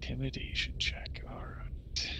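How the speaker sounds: quiet aside whisper. Real, not acted. Player whispers about the intimidation check. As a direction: murmur it under your breath.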